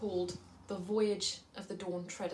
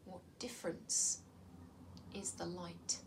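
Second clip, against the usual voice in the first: whisper; 3 semitones lower, 11 dB quieter than usual. Acted. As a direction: whisper it to draw them in. Opening hushed question to assembly.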